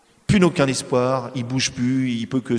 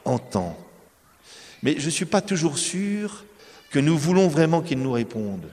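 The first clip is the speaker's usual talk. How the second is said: ordinary talk, nothing marked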